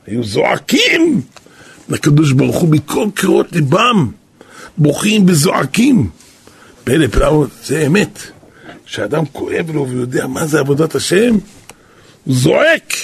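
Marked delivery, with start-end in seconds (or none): none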